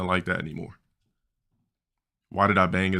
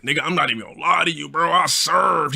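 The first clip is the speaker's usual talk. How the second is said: friend's voice; 8 semitones higher than usual. Retold or imitated. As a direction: brag about it in his high voice.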